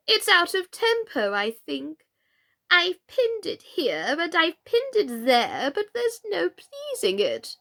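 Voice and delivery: a dithering queen fretting over her shawl. A narrator's reading.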